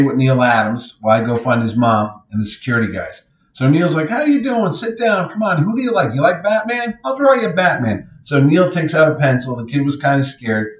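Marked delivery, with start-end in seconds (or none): 4.1-8.1 put-on high, friendly voice for a child; friendly coaxing. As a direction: imitate coaxing a shy kid to relax. Neil puts scared kid at ease.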